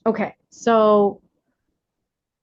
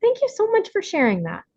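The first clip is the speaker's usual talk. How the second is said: sweet mom reply; 12 semitones higher than usual. Retold or imitated. Mother calmly answering her angry little son, high sweet voice. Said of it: "I can just say to him".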